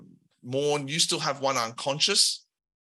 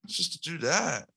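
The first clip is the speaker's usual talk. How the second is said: slacker henchman; 6 semitones higher than usual. Acted. Henchman shrugging off blame to Al, higher and casual. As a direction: play a lazy lackey who just follows orders. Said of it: "The early adopter ... says".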